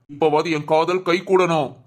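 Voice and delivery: ordinary talk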